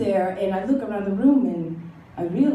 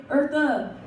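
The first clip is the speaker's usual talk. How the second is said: put-on hippie kids voice; 7 semitones higher than usual. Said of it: "they tell me, they say".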